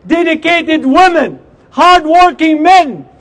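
fiery protest speech; rally the crowd with force; loud soapbox ranting. Acted.

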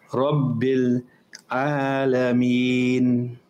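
Rabbil Alameen drawn out slowly, open heavy ra; slow correct recitation. Shown as a demonstration. Teacher modelling slow careful recitation of Rabbil Alameen. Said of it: "It's not ra".

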